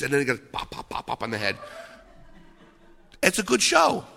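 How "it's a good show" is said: ordinary delivery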